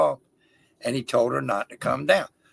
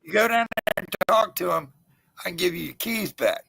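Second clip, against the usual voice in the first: coaxing; 4 semitones higher than usual. Retold or imitated. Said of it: "I said".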